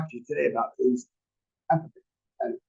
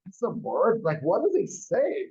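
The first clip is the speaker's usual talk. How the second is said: retold surprise; 7 semitones higher, slower than usual. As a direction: pitch jumps up in mock disbelief. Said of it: "And I'm like".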